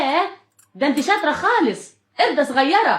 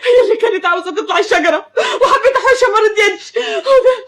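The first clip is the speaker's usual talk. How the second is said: high, crying voice that breaks; tearful pleading; 4 semitones higher than usual. Acted. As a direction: plead through tears. Mother crying over injured child.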